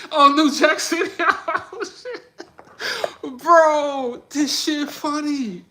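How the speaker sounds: real delighted amusement; laughing outburst. Real, not acted. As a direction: squeal through the laughter. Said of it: "reacting to chat suggestion".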